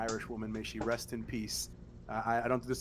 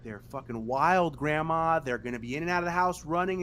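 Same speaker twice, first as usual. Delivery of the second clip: imitating mother; 4 semitones higher, 7 dB louder than usual. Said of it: "And my mother being like".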